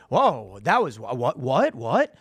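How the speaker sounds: lightly voiced Trump, puzzled rising whats; mock innocent Trump. Trump playing innocent to Biden, lightly mocked. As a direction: feign innocence with rising what. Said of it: "Trump will just go".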